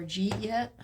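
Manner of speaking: "jeet yet" run together from "did you eat yet"; Southern contraction. Shown as a demonstration. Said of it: "I heard people say".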